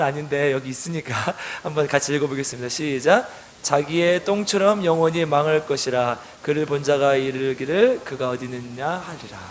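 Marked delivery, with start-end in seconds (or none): 3.6-9.4 slow, lower read-aloud verse; measured congregational Bible reading; scripture reading. A narrator's reading. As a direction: read it out evenly for a group to follow.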